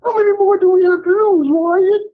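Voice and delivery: high, hammed-up crying plea; mock wailing. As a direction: wail it like a heartbroken fan. Caller hams up boxing heartbreak.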